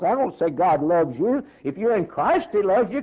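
ordinary talk, nothing marked

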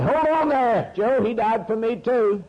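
a high, lightly voiced damned soul; a lightly voiced soul in hell objecting to the preacher; damned man protesting. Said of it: "that fellow in hell said".

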